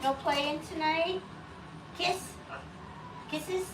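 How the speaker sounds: coaxing pet talk. Real, not acted. Owner asking the bird to play and kiss, high pet talk. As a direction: plead playfully in a high little voice.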